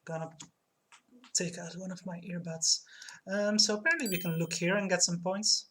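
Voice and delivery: ordinary talk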